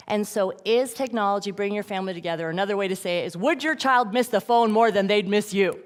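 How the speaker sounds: ordinary delivery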